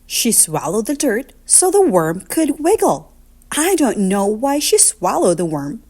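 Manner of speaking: usual speaking voice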